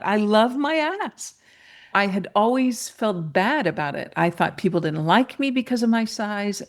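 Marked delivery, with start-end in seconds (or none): 0.0-1.4 high, drawn-out declaration; slow, elated self-affirmation; triumphant motto. Retold or imitated. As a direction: proclaim it slowly with pride, pitch lifted. Repeats her new motto triumphantly.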